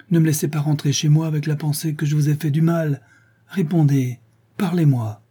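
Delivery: high-pitched, urgent entreaty; pleading. A narrator's reading. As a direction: beg your cousin to answer you. Remorseful girl begs for an answer.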